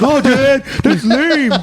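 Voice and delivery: high, mocking teenager; whiny kid brushing off his dad; teen son voice. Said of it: "it's like".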